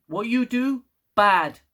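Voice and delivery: high, loud, emphatic explaining with BAD punched; simplified explaining. Crew explaining to the ten C, simplified and emphatic. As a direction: spell it out with a hard hit on "bad".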